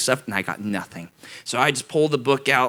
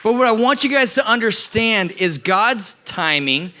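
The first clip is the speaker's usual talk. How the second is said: raised, earnest preaching voice; sermon delivery; 8 semitones higher than usual. Acted. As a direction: drive the message home. Preaching the lesson.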